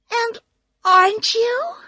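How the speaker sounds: very high, loud question; disbelieving girl. A narrator's reading. Dorothy questioning the supposed wizard, high disbelief. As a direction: pitch it right up, incredulous.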